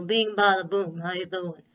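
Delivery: loud 1920s Chicago mobster voice; punchy Chicago mobster accent, first words shouted; Chicago gangster accent. Retold or imitated. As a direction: bark it out like an old Chicago gangster. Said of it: "Fred kinda has that accent".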